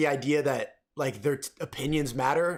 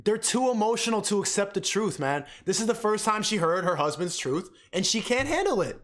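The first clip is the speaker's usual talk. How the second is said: usual speaking voice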